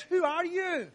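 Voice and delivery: accusing demand. Acted. Sailors confronting Jonah over the storm, accusing. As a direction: confront him with the question.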